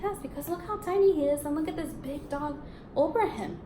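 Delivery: usual speaking voice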